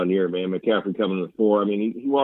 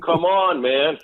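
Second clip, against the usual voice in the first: taunting pro players; 8 semitones higher, slower than usual. Retold or imitated. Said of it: "They're like".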